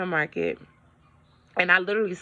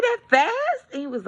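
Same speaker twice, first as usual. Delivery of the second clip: shocked; 11 semitones higher than usual. Retold or imitated. Telling viewers how she reacted to the realtor, shocked and high. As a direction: gasp it out in disbelief. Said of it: "I was like".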